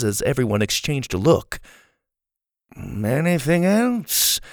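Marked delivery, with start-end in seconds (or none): 2.8-4.4 cheery. A narrator's reading. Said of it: "in a cheery voice".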